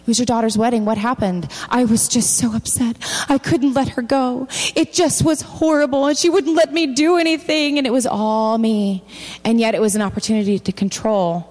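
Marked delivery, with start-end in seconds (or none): 1.7-9.0 whiny self-pity. Acted. Preacher voices a self-pitying parent.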